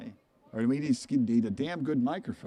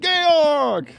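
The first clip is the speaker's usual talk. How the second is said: high, much louder Georg!; screaming; 12 dB louder than usual. Retold or imitated. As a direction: brother screaming the dog's name in German. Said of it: "he starts screaming".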